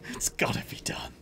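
hushed breathy resolve; soft whispered resignation. Real, not acted. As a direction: whisper it like a grim secret.

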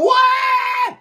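high shouted mock "Ouais!"; a cheering fan's high yell. Mimicking fans cheering Neymar at the start, high and shouted.

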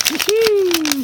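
a high cheering whoop; high whoop of joy; excited whoop. Real, not acted. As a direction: whoop with delight. Cheering at a card.

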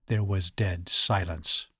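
ordinary delivery, nothing marked

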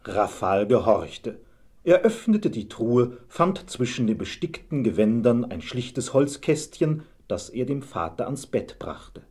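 ordinary delivery

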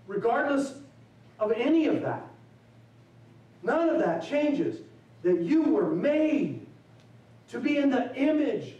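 rising sermon climax. Acted. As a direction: preach it out with swelling conviction. Preacher building to a climax.

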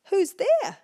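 high, loud cry; cried out. Acted. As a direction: call out to the door. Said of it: "cried Piggy three".

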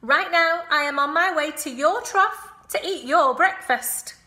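high, loud, sneering rat voice; gloating rat. Acted. Rat heading off to eat the pig's breakfast.

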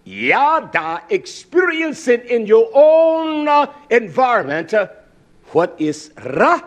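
high, loud preaching climb; rising sermon fervour. Acted. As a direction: drive it up to the crowd. Preaching at full force.

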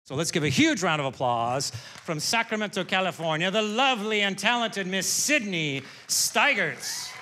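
big, loud MC build to the name; stage announcer introduction. Real, not acted. Host brings on the next comic from Sacramento, big announcer voice. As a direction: announce the act, stretching the name out.

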